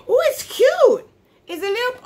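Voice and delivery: real delight, voice shooting up; delighted cooing. Real, not acted. Delighted by cute perfume.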